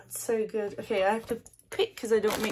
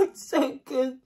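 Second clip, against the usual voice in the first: excited; 5 semitones higher, 5 dB louder, slower than usual. Real, not acted. Loud repeat after whisper.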